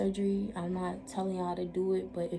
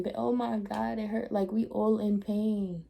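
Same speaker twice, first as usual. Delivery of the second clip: ordinary talk, nothing marked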